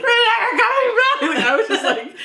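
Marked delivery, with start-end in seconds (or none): 0.0-1.2 retold crying wail. [name] retelling crying to her dad, mock wail. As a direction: wail it like a heartbroken teen. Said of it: "Like, hard crying".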